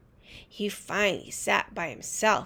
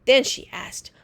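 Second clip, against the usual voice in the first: ordinary delivery, nothing marked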